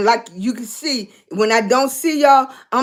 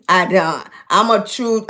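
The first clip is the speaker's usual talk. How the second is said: normal talking voice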